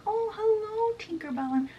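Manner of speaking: high-pitched, sing-out greeting; gushing welcome. Acted. James welcomes Tinkerbell aboard, high gushing greeting. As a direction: greet her in a high, put-on voice. Acted greeting of a glaring fairy.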